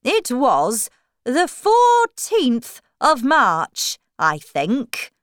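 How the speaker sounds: slow, loud, swooping character voice; Hatter. Acted. Hatter recalling the date in court, slow swooping voice. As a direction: recall it slowly, pitch swinging up and down. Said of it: "the Hatter said".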